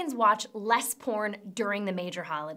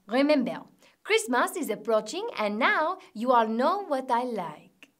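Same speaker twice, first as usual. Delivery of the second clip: lightly faked French president; bad French accent. French president hinting at a gift, in a bad French accent. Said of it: "My French accent is terrible".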